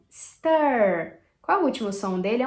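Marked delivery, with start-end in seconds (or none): 0.1-1.2 "stir" drawn out, loud, with an English R; model pronunciation. Shown as a demonstration. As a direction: stretch the "er" in "stir", falling in pitch. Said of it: "você tem esse er de novo".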